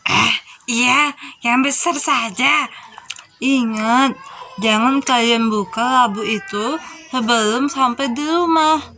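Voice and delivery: put-on elderly woman's voice; old grandmother. Grandmother warns not to open pumpkin early, elderly voice. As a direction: warn them gently like a granny.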